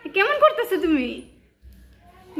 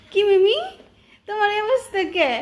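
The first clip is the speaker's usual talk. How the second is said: higher, sing-song coaxing of a scared cat; high cooing pet voice; pet talk; 5 semitones higher than usual. Real, not acted. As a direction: coo at the pet to calm her.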